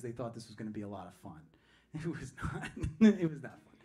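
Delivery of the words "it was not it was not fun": laughing through the words. Real, not acted. Laughs while telling the story.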